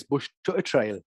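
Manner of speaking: limited attempt at Geordie; rough Geordie impression; Geordie accent. Shown as a demonstration. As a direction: try your one bit of Geordie. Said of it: "All I can say is".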